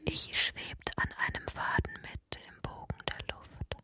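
a faint high whisper from afar. Acted. Narrator whispers Ichi floating on a thread. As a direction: breathe it out, floating.

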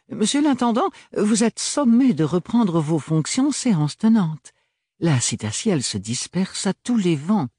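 high, airy official summons; ordering with a smile; mocking Archibald. Archibald donne l'ordre.

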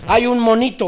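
usual speaking voice

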